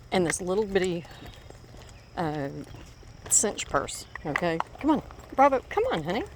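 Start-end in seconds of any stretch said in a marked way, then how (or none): 4.8-6.2 high, sweet coaxing call; calling Bravo higher, like to a pet; coaxing the dog. Real, not acted. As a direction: coax the dog along in a high, loving voice.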